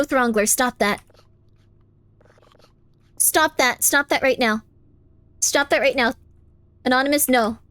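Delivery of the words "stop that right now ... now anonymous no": normal talking voice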